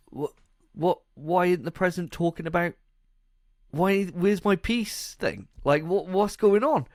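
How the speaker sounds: high-pitched bewildered protest; baffled leader. Acted. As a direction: voice climbing in mock confusion. Acts out baffled waiting leader.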